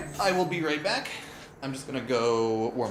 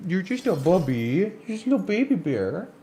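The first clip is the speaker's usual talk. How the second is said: higher sing-song voice for the dog; 8 semitones higher than usual. Real, not acted. Streamer coos at his dog Ruben in higher baby talk. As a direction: coo at him like a puppy.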